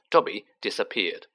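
ordinary delivery

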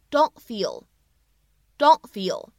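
"don't feel" with the t held back and not released; 不完全爆破 don't feel; incomplete plosion. Shown as a demonstration. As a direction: slowly say "don't feel" without popping the t. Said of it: "不完全爆破".

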